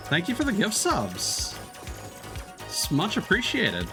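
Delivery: ordinary delivery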